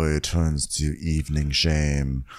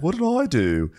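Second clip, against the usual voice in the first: put-on confused boyfriend; 9 semitones higher than usual. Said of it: "He's like".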